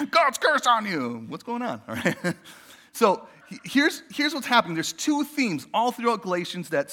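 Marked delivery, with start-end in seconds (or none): 0.1-1.3 slow, louder playful curse; slow mock curse. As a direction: drag it out as a joke curse. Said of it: "would not encourage you to do that".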